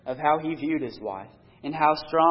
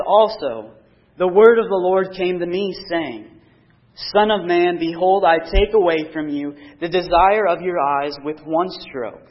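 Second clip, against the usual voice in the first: loud, solemn Bible reading from the pulpit; scripture reading; 5 dB louder than usual. Acted. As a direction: proclaim the verses to the congregation. Preacher reads scripture aloud.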